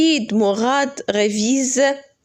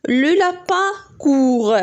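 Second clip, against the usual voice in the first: slow model reading; 4 semitones higher, much slower than usual. Shown as a demonstration. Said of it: "teacher models sentence reading".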